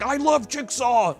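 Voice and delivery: high, slow gushing fan; a hammed-up adoring survivor; mock-adoring fan squeal, pitched high; mock adoring cheer. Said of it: "they're just like".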